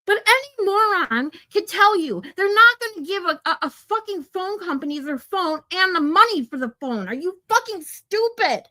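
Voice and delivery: high-pitched, incredulous angry rant; genuinely pissed. Real, not acted. Streamer venting about phone company keeping money, pissed. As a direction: push the pitch up in real exasperation. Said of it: "I get so fucking pissed".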